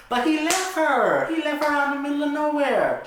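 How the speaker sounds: high, loud protest; indignant insistence. Acted. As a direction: protest the wrong done. Insisting to sleepy friend.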